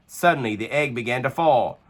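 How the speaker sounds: usual speaking voice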